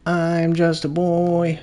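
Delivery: put-on high, slow, babyish Australian-free boy voice; innocent little boy. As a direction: plead innocence like a little kid. Excuse about forgetting viewers.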